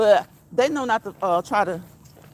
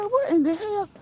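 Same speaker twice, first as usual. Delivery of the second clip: mock holler; 11 semitones higher than usual. Streamer retelling her reaction to a friend, high mock holler. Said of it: "Girl, I was hollering".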